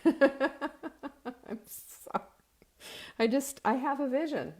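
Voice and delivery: drawn-out sorry broken by laughter; can't stop laughing while apologizing; real laughter, very slow. Real, not acted. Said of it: "Sorry I'm laughing".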